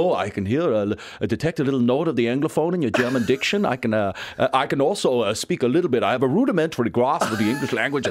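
lightly voiced German shopkeeper in overly formal English; posh German shopkeeper. Comedian mimics shopkeeper answering his German: lightly hammed formal clerk. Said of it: "they go".

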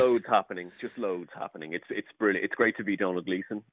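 put-on low, understated Dublin accent as Donald Gleeson. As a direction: keep up the Dublin pose. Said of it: "this individual has a Dublin accent".

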